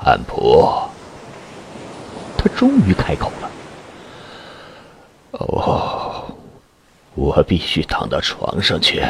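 low, weak, moaning voice; sick and in pain. A narrator's reading. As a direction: groan through a pounding headache. Sick man in pain begging.

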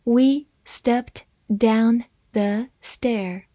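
word-by-word, with pauses between; slow, measured model reading. Shown as a demonstration. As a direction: read slowly for learners to follow. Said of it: "慢慢来读".

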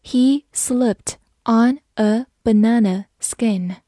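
slow, word-by-word model reading; slow read-through. Shown as a demonstration. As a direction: take the sentence slowly, each word apart. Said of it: "慢慢来读". (慢慢地逐词朗读)

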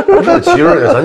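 a high laugh running into the words; cracking up, then trying to speak. Real, not acted. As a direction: talk through the laughter. Joke "人生结束了.

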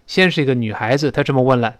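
normal talking voice

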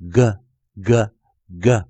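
"g" as a short hard "guh", three separate beats; phonics sound demo. Shown as a demonstration. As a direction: sound out "g" slowly, one at a time. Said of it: "也就是去读出G在第一回合中所". (慢慢示范"g"读"格"，逐个停顿)

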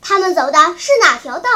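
fast, loud questioning; quick, forceful question; urgent demand. A narrator's reading. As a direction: demand the route at once. Urgent question before the chase.

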